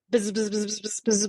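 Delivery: soft, repeated bee buzzing; imitated bee buzz. Retelling a bee loose in the house, buzzing noises. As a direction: imitate a bee circling the room. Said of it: "you hear a bee".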